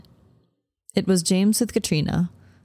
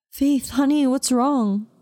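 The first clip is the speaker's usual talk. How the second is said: worried sister; 7 semitones higher, slower than usual. Acted.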